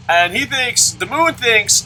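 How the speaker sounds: ordinary talk, nothing marked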